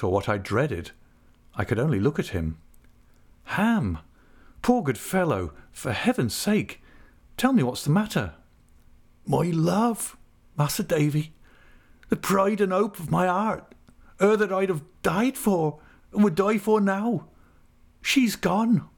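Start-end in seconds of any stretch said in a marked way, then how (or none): 3.6-8.3 higher-pitched, earnest plea; urgent, imploring concern; anxious pleading. Acted. Narrator pleads with weeping friend. 9.3-18.9 high-pitched, anguished countryman; distraught rustic voice, pitched high; heartbroken Ham. Acted.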